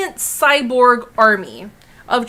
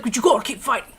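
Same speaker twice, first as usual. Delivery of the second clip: badly faked Australian pep talk; botched Australian accent; 2 semitones lower, much faster than usual. Hawkeye giving a pep talk to Scarlet Witch, botched Australian accent. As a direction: attempt an Australian accent and miss. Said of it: "It's not Australian".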